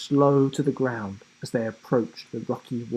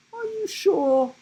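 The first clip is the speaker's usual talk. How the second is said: giraffe voice. A narrator's reading. Giraffe doubting.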